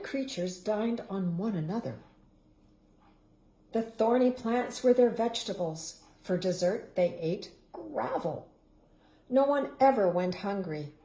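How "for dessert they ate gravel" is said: ordinary delivery, nothing marked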